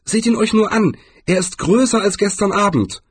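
hohe, lebhafte Trollstimme; anderer Troll. Acted. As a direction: zeigt aufgeregt zum Himmel. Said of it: "warf ein anderer Troll ein". (hohe, lebhafte Trollstimme)

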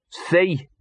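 high, mournful dog character. A narrator's reading. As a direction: lament it as a hard-done-by dog. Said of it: "said Gaspode mournfully".

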